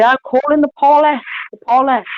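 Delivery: a badly done Scottish threat to call the police; a neighbour in a Scottish accent calling the police. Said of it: "I can't do her accent".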